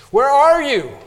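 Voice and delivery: loud high cry, "are" hit hard; calling out to the Lord. Retold or imitated. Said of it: "I love to say this".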